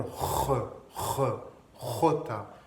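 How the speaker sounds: "jota" opening on a rasped throat sound; deep throat jota. Shown as a demonstration. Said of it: "mais plus profond". (« jota » avec un R plus profond, en gorge)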